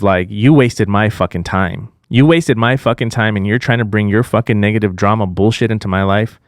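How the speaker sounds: ordinary delivery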